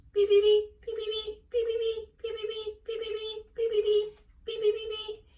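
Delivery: put-on very high, loud baby peeps; peeping gooselings. A narrator's reading. Gooselings answering Charlotte's roll call, squeaky high peeps. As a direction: chirp like a row of tiny goslings. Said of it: "said the Gooselings".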